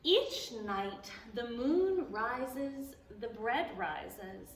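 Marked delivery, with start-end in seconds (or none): none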